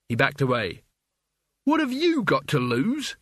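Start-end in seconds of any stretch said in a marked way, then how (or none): 1.6-3.2 high indignant shout. Acted. Mort throws Cutwell's words back at him, shouting. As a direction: fling the question back at him, pitched up. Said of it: "shouted Mort".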